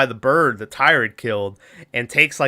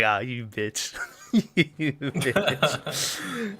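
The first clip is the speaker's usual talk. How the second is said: laughing mock insult; 8 dB quieter, much slower than usual. Real, not acted. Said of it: "I was like".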